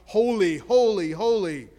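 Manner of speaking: loud ringing cry of holy; angels shouting. A narrator's reading. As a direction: call it out like angels filling the temple.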